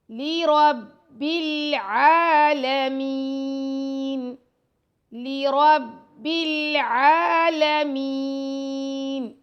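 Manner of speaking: لی رب العالمین with a clear ee in لی; slow recitation. Shown as a demonstration. Teacher models the correct lee reading for students. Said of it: "لی کر کے پڑھنا".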